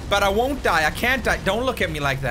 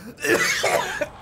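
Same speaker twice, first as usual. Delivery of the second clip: cough; 7 semitones higher than usual. Real, not acted. Coughing after wasabi.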